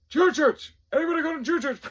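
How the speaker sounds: high, very loud roll-call shout; drill sergeant yelling. Retold or imitated. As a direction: bellow it across the formation. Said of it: "Like, yelling".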